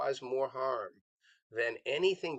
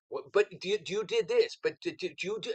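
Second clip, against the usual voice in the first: mock interrogation, pitched up and pushy; attack mode; 6 semitones higher than usual. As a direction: imitate an interviewer pouncing with rising voice and energy. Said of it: "the voice goes up, the energy, it's this, it's the attack mode".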